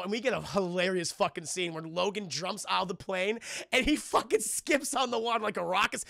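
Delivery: ordinary talk, nothing marked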